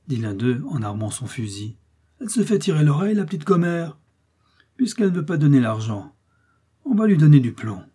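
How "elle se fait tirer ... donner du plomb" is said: raised, loud menace; impatient threat. A narrator's reading. Brigand cocking his gun at the woman, threatening. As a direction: call it out tauntingly, losing patience.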